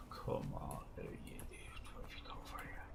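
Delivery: hushed unvoiced whisper. Real, not acted. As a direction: whisper while sneaking. Sneaking up, voice drops.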